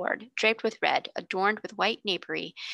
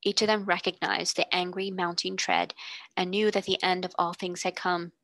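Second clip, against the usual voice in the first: plain reading voice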